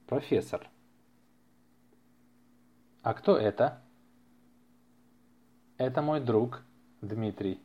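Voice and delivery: ordinary talk, nothing marked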